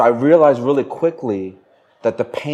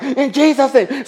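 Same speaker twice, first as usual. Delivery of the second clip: high-pitched reenacted prayer shout; mock high yell of a panicked prayer; raised voice; 11 semitones higher than usual. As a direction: imitate praying with a raised, urgent voice. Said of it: "So I started raising my voice".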